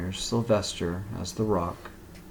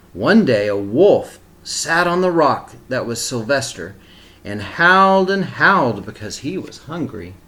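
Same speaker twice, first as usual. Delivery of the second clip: put-on reading a picture book aloud to children; steady, expressive storybook reading; story narration; 7 dB louder than usual. A narrator's reading.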